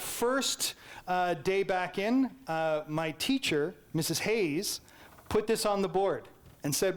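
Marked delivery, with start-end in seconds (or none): none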